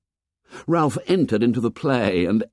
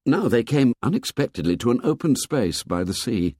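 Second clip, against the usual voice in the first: ordinary delivery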